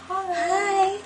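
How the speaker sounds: high, sugary hello to a loved one; sweet phone greeting. Retold or imitated. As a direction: coo it like greeting your honey. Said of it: "and I'm like".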